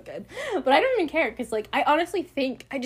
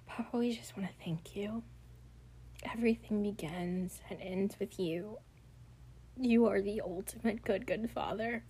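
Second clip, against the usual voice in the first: hushed, unvoiced prayer to God; quiet intimate prayer; whispered prayer; 9 dB quieter than usual. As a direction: pray softly over the listeners. Leads a closing prayer.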